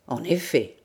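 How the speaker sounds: low, subdued concession; disconcerted admission. A narrator's reading. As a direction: admit it, taken aback. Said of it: "avoua Gourel déconcerté".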